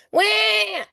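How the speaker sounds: high mock baby wail; imitated toddler cry. Toddler crying in the aisle.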